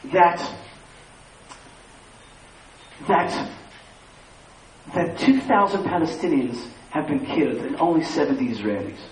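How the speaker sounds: mock solemn British news interrogator; TV interviewer. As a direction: imitate a grave anchor putting a question, with heavy pauses. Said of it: "they go".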